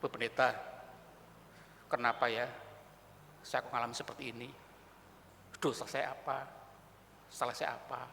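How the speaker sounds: low, soft plea for answers; acted subdued, bewildered questioning; troubled churchgoer. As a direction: confide quietly, as if worn down by struggle. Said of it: "Bergumul-bergumul, tidak punya jawaban, datang pada pendeta".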